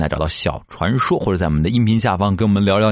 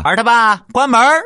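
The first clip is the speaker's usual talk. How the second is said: high, loud call to the husband; 13 semitones higher, 5 dB louder than usual. Retold or imitated. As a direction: call across the house, pitched up. Mom calls dad to shut door.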